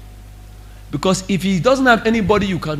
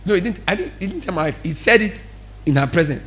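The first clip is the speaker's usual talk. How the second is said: ordinary talk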